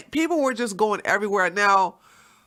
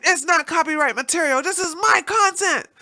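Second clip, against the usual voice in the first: indignant protest; 8 semitones higher than usual. Retold or imitated. Retelling protest against takedown.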